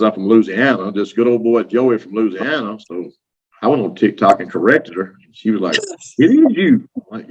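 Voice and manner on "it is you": high, excited imitation of Amy; pitched way up in mock amazement; mock surprised. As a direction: squeal it like spotting a celebrity. Amy recognizing him, retold.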